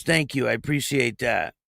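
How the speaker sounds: ordinary delivery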